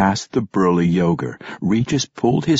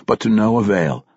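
ordinary delivery

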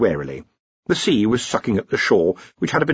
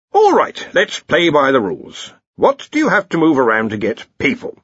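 loud, raised commanding voice; brisk Arch-Chancellor; 6 semitones higher, 5 dB louder than usual. Acted. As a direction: take charge of the meeting loudly. Arch-Chancellor takes charge.

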